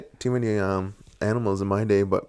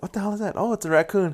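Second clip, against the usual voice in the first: higher pitched, puzzled then realizing; startled at night; 9 semitones higher, 3 dB quieter, faster than usual. Retold or imitated. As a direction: imitate spotting eyes in the ditch. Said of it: "and I was like".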